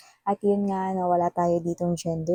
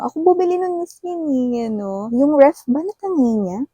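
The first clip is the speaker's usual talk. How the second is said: much higher, louder mock outrage; mock outraged client; 6 semitones higher, 4 dB louder than usual. Client complaining the ex took the ref, high mock outrage. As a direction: squeal it like an indignant client. Client voiced about stolen appliances.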